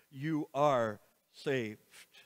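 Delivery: You are saved, said very slowly, each word weighted; slow right down on You are saved; slow emphatic. Shown as a demonstration. Preacher driving home the promise to listeners, slow and emphatic.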